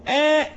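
آ high and thin, not heavy; high demonstration of a thin آ; light hamza. Shown as a demonstration. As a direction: keep the vowel of آ light after a firm onset. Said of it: "مرققة".

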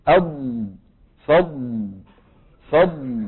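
فض with a long ض; drawn-out أض and فض; slow ض demo. Shown as a demonstration. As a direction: stretch the ض in فض. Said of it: "واضح الصوت؟".

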